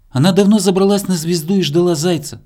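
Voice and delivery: ordinary delivery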